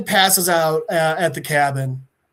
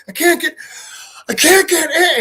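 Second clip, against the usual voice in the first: high, loud, strained retold cry; acted frantic frustration at being locked out; angry, pained; 10 semitones higher, 3 dB louder than usual. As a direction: imitate a kid losing it. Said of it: "he's also getting angry".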